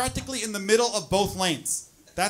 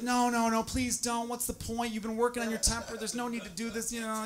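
higher, softer, gently begging wife; pleading wife; 6 semitones higher, 3 dB quieter than usual. Retold or imitated. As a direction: talk him down softly like his wife.

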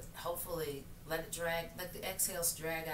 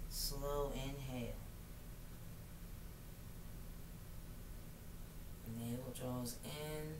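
guided meditation; 3 semitones lower, 5 dB quieter than usual. Acted. Leads yoga breathing.

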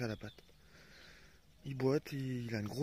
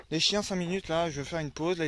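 normal talking voice

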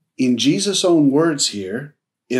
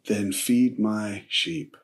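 softer, slow, simply stated; simple and quiet; 4 semitones lower, 6 dB quieter, slower than usual. Retold or imitated. Jesus answers Peter simply, quiet and slow. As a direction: say it plainly and gently, no fuss. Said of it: "he just simply says".

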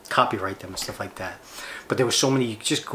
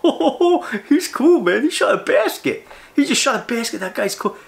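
very high, loud fanboy squeal; over-the-top mock awe, shouted high; mock gushing fan; 12 semitones higher, 4 dB louder than usual. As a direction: parody starstruck admirers at the top of your voice. Mocks gushing fans of a president.

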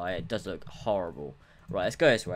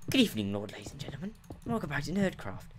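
put-on nerdy show host voice; mock nerd presenter, pitch jumping up; Jemmy Nerd; 5 semitones higher, faster than usual. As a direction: greet the viewers as a hammed-up nerd. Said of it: "I always go into the Jemmy Nerd voice".

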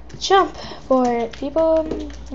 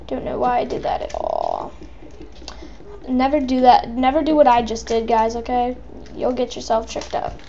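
ordinary delivery

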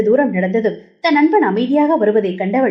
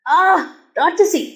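high laughing protest at an ear twist; mock pained, laughing; 7 semitones higher than usual. A narrator's reading. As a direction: yelp and laugh as she twists his ear. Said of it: "சிரிப்புடன் கூறினான்".

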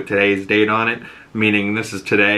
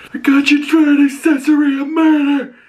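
very high, loud strained shout; raspy police chief; 5 dB louder than usual. Retold or imitated. Police chief accusing Sophia, strained raspy shout. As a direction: force it out of the throat breathlessly. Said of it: "his voice".

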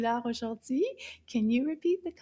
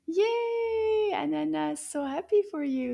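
high, held "yay"; high celebratory cry; happy cheer; 4 semitones higher than usual. Pretend. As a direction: cheer for the children's success. So happy for you.

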